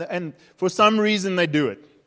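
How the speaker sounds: normal talking voice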